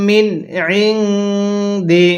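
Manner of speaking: ইনদি slow, first nun clear, second nun nasalised with ghunna; মিন ইনদি with clear nun then ghunna; clear then nasal nun. Shown as a demonstration. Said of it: "প্রথম নুনটা স্পষ্ট করে পড়তে হবে".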